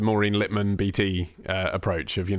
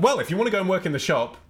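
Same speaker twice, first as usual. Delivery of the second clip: angry parent; 10 semitones higher than usual. Retold or imitated. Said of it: "they just flipped out at me".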